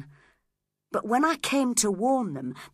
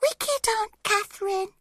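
put-on a small child's high whimpering voice; sobbing child. A narrator's reading. Baby Hareton sobbing at his aunt, high and tearful. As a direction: whimper it through tears like a toddler. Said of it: "started sobbing and saying".

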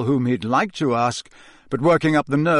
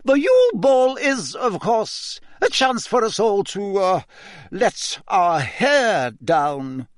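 high, slow, prim disapproval; disapproving; 7 semitones higher, slower than usual. Acted. Teacher reluctantly telling students to relax, disapproving. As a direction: say it like it pains her to allow fun. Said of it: "she said in a disapproving voice".

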